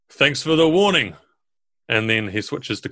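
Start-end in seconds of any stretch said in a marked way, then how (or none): 0.1-1.2 ogre leader calling out, a touch higher. Acted. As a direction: call out a thanks as the ogre. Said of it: "he calls out, um, first in Orcish".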